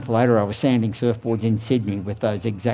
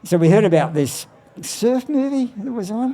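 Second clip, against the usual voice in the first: ordinary talk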